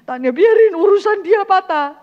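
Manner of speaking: high, loud hammed-up shout; mock shout. Preacher mimicking a hurt person who ignores others, loud mock shout. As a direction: brush off the broken leg loudly, self-absorbed. Mocking self-centered wounded person.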